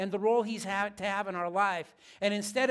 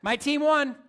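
triumphant brag; 6 semitones higher, 8 dB louder than usual. Real, not acted. Gloating over game win.

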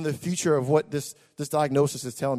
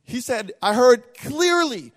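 slow preached emphasis; 6 semitones higher, 7 dB louder, slower than usual. Acted.

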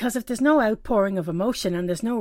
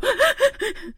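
hiccuping sob; 4 dB louder than usual. Retold or imitated. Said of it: "every so often I'd go".

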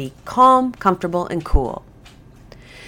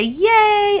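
much higher, bright puppy-party cheer; really fun voice. Shown as a demonstration. Trainer hands out kibble to the dog, high excited cheer. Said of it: "I say it in a really fun voice".

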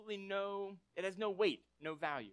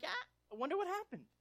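high, airy puzzled musing; clueless Peter; 13 semitones higher, faster than usual. Retold or imitated. Peter at the empty tomb, puzzled and high-voiced. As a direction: play Peter as cheerfully baffled.

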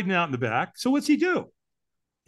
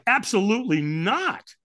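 slow, high, drawn-out refusal; imitated firm dismissal, higher and slow; flat refusal tonality; 6 semitones higher, slower than usual. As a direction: stretch out an emphatic refusal. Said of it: "I said it to him in that tonality".

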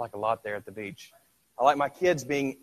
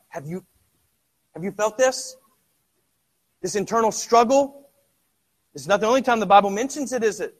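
high, loud, fast impassioned preaching; full-force preaching; 9 semitones higher, 9 dB louder than usual. Acted. Preacher presses the congregation on inner struggle, loud and fast. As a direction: drive it home to the congregation.

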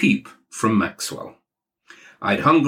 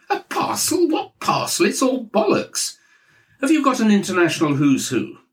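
much higher scornful boom; Maxwell booming; 11 semitones higher than usual. Acted. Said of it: "He was booming down the line".

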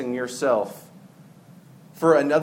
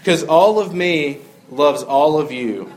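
loud, slow, half-sung lyric reading; reciting song lyrics; 7 dB louder than usual. Acted. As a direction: declaim the love song lyrics with a wink. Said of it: "I know it sounds cheesy".

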